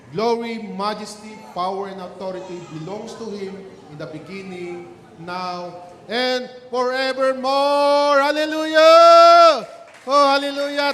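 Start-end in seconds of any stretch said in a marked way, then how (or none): 6.1-10.9 soaring, loud, slowed praise cry; hallelujah shout. Real, not acted. Preacher rousing the congregation, loud joyful shout. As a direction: lift the church with a joyful shout.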